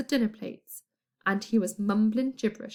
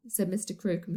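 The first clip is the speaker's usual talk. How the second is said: ordinary delivery, nothing marked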